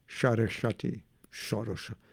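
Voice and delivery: "Saraswati" as Bengali shoro-shoti; Bengali-accented "Saraswati"; Bengali pronunciation. Shown as a demonstration. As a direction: soften "Saraswati" into Bengali vowels. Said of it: "if you're Bengali".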